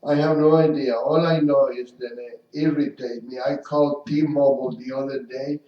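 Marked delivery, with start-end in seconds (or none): none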